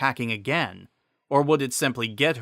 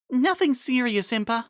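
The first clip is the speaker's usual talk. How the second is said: much higher voice, tired but brushing it off; a young noblewoman shrugging off pain; weary Miranda; 12 semitones higher than usual. Acted. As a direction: Miranda reassuring Impa after her fall, weary.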